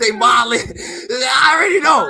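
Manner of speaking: loud, slow cry through laughter; laughing shout. Real, not acted. Half crying, half laughing about thugging men. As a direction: wail it out while cracking up.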